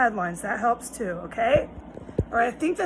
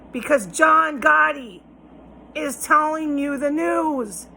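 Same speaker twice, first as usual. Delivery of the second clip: slow, each word weighed, louder on John Gotti; slow emphatic warning; 4 semitones higher, 4 dB louder, slower than usual. Real, not acted. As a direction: spell it out slowly so it sinks in. Drilling a point into viewers.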